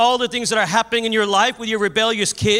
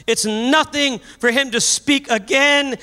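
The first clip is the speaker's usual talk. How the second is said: normal talking voice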